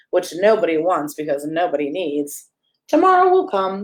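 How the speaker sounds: ordinary delivery, nothing marked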